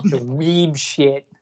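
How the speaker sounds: drawn-out, lightly put-on rapper voice; slowly, with a hint of impersonation. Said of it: "use my [name] voice".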